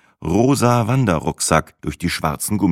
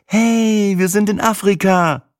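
hohe, laute, strahlende Frauenstimme; strahlend begeistert; 4 dB louder, slower than usual. A narrator's reading. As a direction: Sina jubelt vor Freude über die Ankunft. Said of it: "strahlte Sina wie ein Eichhörnchen". (begeistert strahlende Sina)

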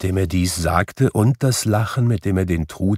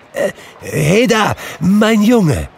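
put-on high, loud call to a boy; excited, drawn-out holler; hailing; 6 dB louder, slower than usual. A narrator's reading. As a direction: shout down to the street. Scrooge hails boy in street.

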